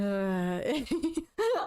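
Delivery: high laugh tipping into crying; rising, tearful laugh. Real, not acted. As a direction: laugh helplessly until it sounds like sobbing. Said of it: "I am crying".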